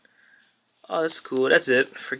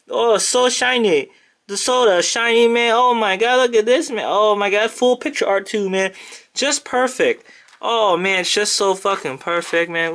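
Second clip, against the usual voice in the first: higher and louder, breathless awe; excited gushing; 7 semitones higher, 3 dB louder than usual. Real, not acted. Fan admires the signed shiny card, excited gushing. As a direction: rave over the signed card, thrilled.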